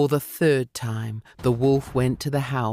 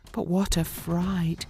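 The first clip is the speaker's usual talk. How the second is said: plain reading voice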